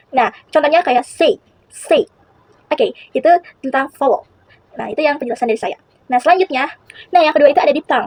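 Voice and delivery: ordinary delivery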